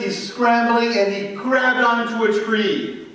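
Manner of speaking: ordinary delivery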